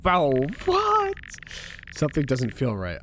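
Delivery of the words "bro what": shocked disbelief. Real, not acted. After Daniel's threat.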